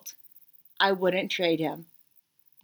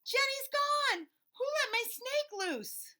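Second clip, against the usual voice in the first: high, slow panicked shout; 8 dB quieter than usual. Acted. Emily shouting to the class about her lost snake, high panicked yell. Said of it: "Emily shouts".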